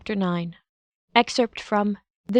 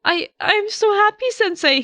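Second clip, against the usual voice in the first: put-on high, loud boyish voice through tears; happy crying, high and cracking; tearful high boy, overjoyed; blubbering; 12 semitones higher, 4 dB louder than usual. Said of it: "he blubbers".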